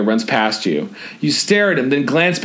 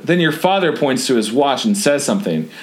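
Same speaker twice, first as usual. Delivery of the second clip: ordinary talk, nothing marked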